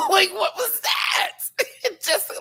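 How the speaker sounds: very high, laughing through the words; laughing shriek. Real, not acted. Recapping Jimbo's face to viewers, laughing high. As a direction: squeal it out while cracking up.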